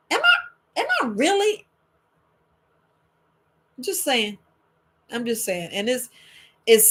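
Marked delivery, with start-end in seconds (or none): 0.1-1.7 high, stunned am I really; stunned self-doubt. Retold or imitated. Listener realising the advice is wrong, stunned high doubt. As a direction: let the voice jump up in disbelief. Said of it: "You be like, Wait a minute".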